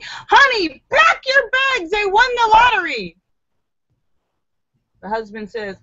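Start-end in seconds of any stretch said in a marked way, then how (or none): 0.0-3.2 high, thrilled shouting; wife bursting in with lottery news, shouting excitedly. A narrator's reading. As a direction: burst in yelling the big news. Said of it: "shouts excitedly".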